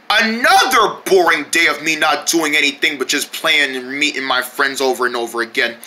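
low, droning monotone; bored grumbling. Acted. Bored character musing alone, low weary drone. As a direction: drone on as if fed up.

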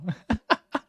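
a light high laugh. Real, not acted. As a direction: burst out laughing. Laughing after imitating.

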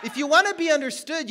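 loud, high shout with a laugh in it; a preacher laughing as he shouts. Acted.

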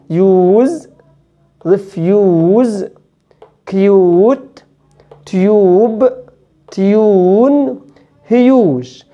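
كيوت said slowly as "kyoot" with a clear "y"; "yoo" sound. Shown as a demonstration. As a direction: put a clear "y" glide before "oo" in يوز، ريفيوز، كيوت، تيوب، تيون، هيوج. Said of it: "نقولهم تاني". (نطق يو مع يا قبلها)

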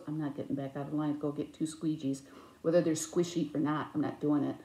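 low, soft, deadpan refusal; unimpressed husband. Retold or imitated. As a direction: imitate a husband quietly refusing.

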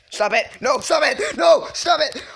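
high, loud frantic pleading; real high-pitched gamer panic; panicked yelling. Real, not acted. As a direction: yelp in panic as the attacker closes in. Being knifed in the game.

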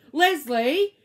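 teacher snapping a name out loud, rising; teacher scolding a pupil by name, loud exclamation; sharp exclamation. A narrator's reading. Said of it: "exclaimed Missus Jules".

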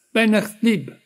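high, loud call tossed off with a laugh. A narrator's reading. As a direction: shout it gaily over your shoulder. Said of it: "gridò la signorina Ivor con una risata".

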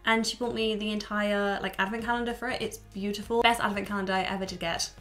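normal talking voice

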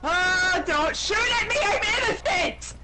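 high frantic plea; panicked yelling. Real, not acted. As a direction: beg them not to shoot.

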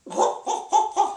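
loud, high ho-ho-ho; mock laughter. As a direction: burst out in booming hos. Acts out own reaction to antics.

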